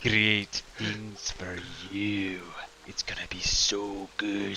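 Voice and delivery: hushed, drawn-out coaxing; patronizing. Acted. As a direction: lure slowly, softening to a near whisper. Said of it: "That's really patronizing".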